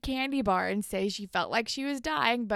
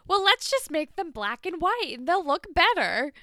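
higher, louder chirpy know-it-all; mock airhead; 8 semitones higher, 7 dB louder than usual. Mocking Paige's suggestion at the photo shoot, higher chirpy voice. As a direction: ham up the clueless intern's bright idea.

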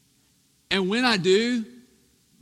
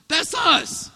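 mock child excuse; 7 semitones higher, 8 dB louder than usual. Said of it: "I was like".

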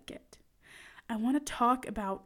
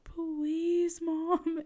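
put-on higher, pleading little-boy voice; begging child; 6 semitones higher than usual. Mom imitating her preschooler begging to stay home, higher and pleading. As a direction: plead like her small son. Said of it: "so cute when he begs me".

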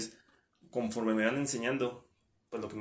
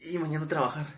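whiny dread; 5 semitones higher, 4 dB louder, faster than usual. Retold or imitated. Retold Sunday dread, whining.

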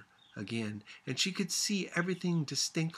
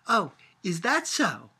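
sharp, louder retort; an indignant, stung reply; angry; 5 semitones higher, 8 dB louder than usual. A narrator's reading. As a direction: bristle back at her. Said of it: "she said angrily".

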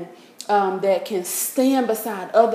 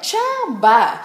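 dismissive; 4 semitones higher, 4 dB louder than usual. Real, not acted. Dismissing a cheap date.

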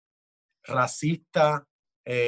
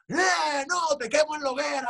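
hammed-up furious shout; mock inquisitor threat; 10 semitones higher than usual. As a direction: snap it like a zealot threatening the stake.

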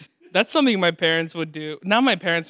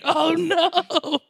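high, drawn-out wail; mock childish dismay; 12 semitones higher, 4 dB louder than usual. Imitating his scared childhood self, high mock wail. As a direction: cry it out in comic dread.

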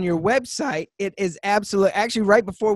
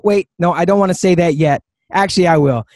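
ordinary talk

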